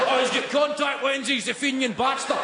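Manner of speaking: high-pitched, hammed-up indignant fan; exaggerated high exclamation; mock outrage. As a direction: cry out like a scandalised pub regular. Acted pub fan's outraged discovery.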